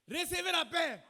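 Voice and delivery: high, loud scriptural proclamation; proclaimed command. Acted. Preacher quoting Jesus to the congregation, loud proclaimed command. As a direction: declare it out over the congregation.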